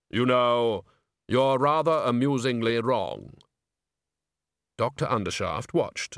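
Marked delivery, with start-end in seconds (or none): 0.1-3.4 slow, dry Death voice; bass voice. Acted. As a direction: speak as calm, grave Death. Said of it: "you do have a very good bass voice".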